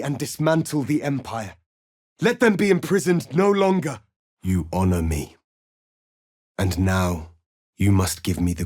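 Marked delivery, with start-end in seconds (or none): 2.2-4.0 higher, louder battle cry; rally the troops to break the chains; rousing rallying cry. Acted. Leader rallies allies to free his people, rousing cry.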